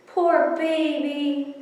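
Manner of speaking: high slow tender coo. Acted. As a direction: coo to a kitten. Cooing at kitten.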